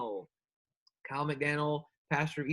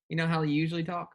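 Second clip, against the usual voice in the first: ordinary talk, nothing marked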